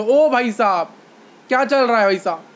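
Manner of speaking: loud call-out as a volume demo. Shown as a demonstration. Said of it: "जितनी जोर से आप बोलोगे".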